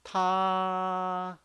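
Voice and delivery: "Ta" held very long, high and level; long, unwavering high "Ta"; prolonged first tone. Shown as a demonstration. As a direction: keep "Ta" high and steady, drawn right out. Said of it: "very long".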